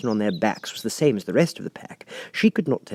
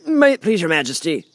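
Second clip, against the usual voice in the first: humble gardener; 9 semitones higher, 5 dB louder than usual. A narrator's reading. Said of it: "in a very humble tone".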